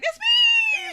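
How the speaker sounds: very high, loud imitated squeal; mock shriek. Fan re-enacting the doll moment, mock shrieking. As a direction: burst out shrieking, hammed up. Imitating singer recognising himself.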